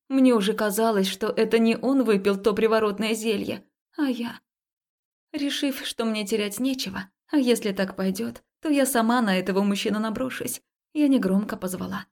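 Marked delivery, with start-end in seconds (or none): none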